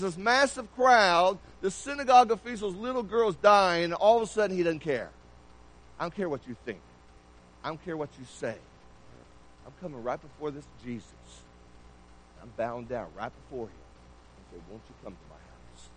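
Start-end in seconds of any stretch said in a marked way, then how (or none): 6.0-15.9 softer, low, determined inner voice; quiet resolve. Acted. Desperate father deciding to kneel before Jesus, quiet resolve. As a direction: say it low, setting pride aside.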